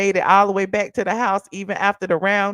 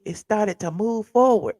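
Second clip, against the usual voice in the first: ordinary talk, nothing marked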